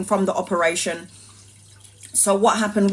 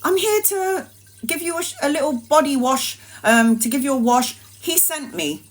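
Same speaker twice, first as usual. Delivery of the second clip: little nurse voice; 6 semitones higher than usual. Retold or imitated. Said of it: "she goes".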